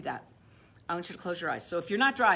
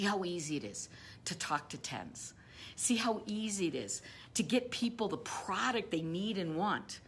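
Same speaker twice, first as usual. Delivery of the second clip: whisper; 4 dB quieter than usual. Acted. Guided visualization, hushed.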